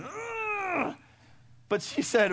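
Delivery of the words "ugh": high, strained grunt with a touch of crying; child's straining grunt. Retold or imitated. Parent imagining the kid's worrying grunt.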